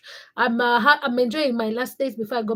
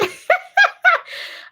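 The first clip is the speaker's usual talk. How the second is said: high, loud burst of giggles; real amused laughter; 6 dB louder than usual. Real, not acted. Laughs at own sleepy routine.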